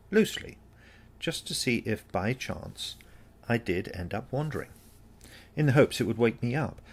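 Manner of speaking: plain reading voice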